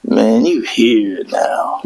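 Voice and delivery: slow, low demonic whisper. Retold or imitated. Marine greeting recruits off the plane in a slow demonic whisper. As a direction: breathe it out like a demon. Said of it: "in the most demonic way".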